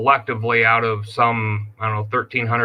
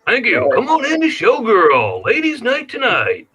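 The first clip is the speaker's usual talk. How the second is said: radio DJ voice; 11 semitones higher than usual. Pretend. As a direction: hype it up like a club emcee. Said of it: "Sound like a radio D J there".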